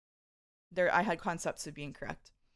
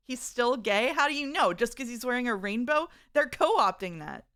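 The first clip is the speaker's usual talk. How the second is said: mock outrage; 4 semitones higher, 6 dB louder than usual. Mock outrage reading chat.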